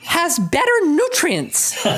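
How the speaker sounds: hammed-up imperious old lady, laughing as she goes; Lady Bracknell voice. A dowager in the audience lecturing the room on nutrition, grand and high. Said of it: "in a very Lady Bracknell voice".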